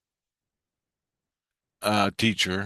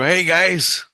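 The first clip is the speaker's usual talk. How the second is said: raised, high hail to people upstairs; calling out; 9 semitones higher, 5 dB louder than usual. Retold or imitated. Sleeper calls to kids talking above, calling out. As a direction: call up to the kids, higher and louder.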